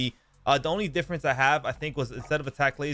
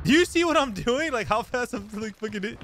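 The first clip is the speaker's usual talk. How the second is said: higher, fast excited boasting; thrilled, pitched up; hyped brag; 8 semitones higher than usual. Real, not acted. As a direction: show off to the viewers. Bragging about his play.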